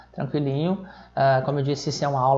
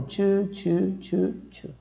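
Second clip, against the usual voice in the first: each "two" on its own, pitched higher; higher, separated digit-by-digit count; one by one; 4 semitones higher, much slower than usual. Shown as a demonstration. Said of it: "Ou você lê um por um".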